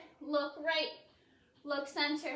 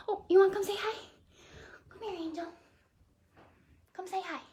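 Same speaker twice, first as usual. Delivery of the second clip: higher, sing-song coaxing of a pet; coaxing pet talk; 5 semitones higher, 3 dB louder, faster than usual. Real, not acted. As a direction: call the pet over sweetly. Coaxing the pet Angel over.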